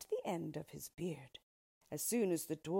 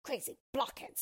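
irritable dwarf, higher pitched; mild snap; 6 semitones higher than usual. A narrator's reading. Dwarf to the girls offering to fetch help, mildly snappish. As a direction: snap crossly at useless helpers. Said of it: "snapped the dwarf".

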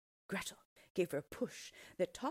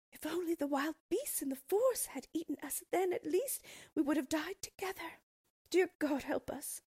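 put-on high, louder frightened child's plea; pleading cry; 11 semitones higher, 5 dB louder than usual. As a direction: beg for help in terror. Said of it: "they cried".

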